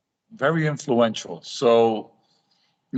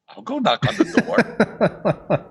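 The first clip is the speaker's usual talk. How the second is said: high, slow, laughing through the words; 9 semitones higher, slower than usual. Retold or imitated. Telling a friend his cheeky plan, laughing. Joking plan to knock on door.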